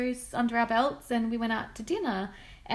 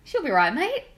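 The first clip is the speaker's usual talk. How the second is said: loud broad Aussie reassurance; she'll be right mate, ocker style; aussie tradesman; 5 semitones higher, 6 dB louder than usual. Retold or imitated. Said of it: "So I had a go".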